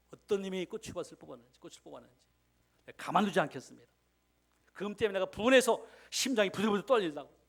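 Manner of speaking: shouting in outrage; angry deaconess. Retold or imitated. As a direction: voice the furious old lady. Angry deaconess voiced in story.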